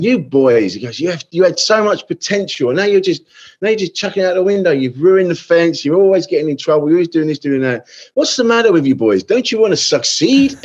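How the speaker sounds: mock the headmaster's pompous scolding; headmaster telling off. Headmaster scolding a line of boys, mock stern.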